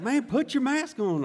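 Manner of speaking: loud, high Southern squad leader; mock Southern sergeant's command; Southern accent. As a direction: order it slowly in a put-on Southern drawl. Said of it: "That's my best Southern accent".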